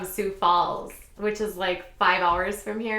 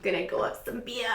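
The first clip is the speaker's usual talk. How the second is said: put-on quick, softer Boston accent through a laugh; thick Boston accent; 3 semitones higher, 6 dB quieter, faster than usual. Imitating the ex-boyfriend from the Cape, laughing Boston accent. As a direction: mimic a Bostonian while laughing. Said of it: "he had a thick accent".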